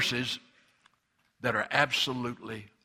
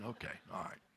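mild whisper; 5 semitones lower, 16 dB quieter than usual. Acted. Lord answering the preacher.

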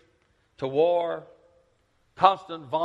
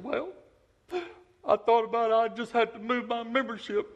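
higher voice of a lady; lightly voiced elderly woman; old lady's voice; 8 semitones higher than usual. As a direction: play the old church member.

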